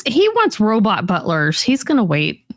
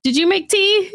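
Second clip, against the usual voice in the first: much higher, slow, innocent mock voice; clueless question; 11 semitones higher than usual. Husband asking his crying wife about tea, mock-innocent. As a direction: play dumb and oblivious. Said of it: "And you're like".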